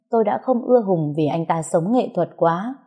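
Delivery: ordinary delivery, nothing marked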